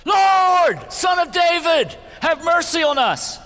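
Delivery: very high, very loud pleading cry. A narrator's reading. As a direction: scream it over the crowd, desperate. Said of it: "But they only shouted louder".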